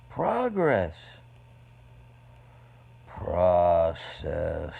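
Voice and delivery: hammed-up poem building to a loud punchline; building poem recital. Shown as a demonstration. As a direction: build each word up to the joke. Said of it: "I wrote a poem".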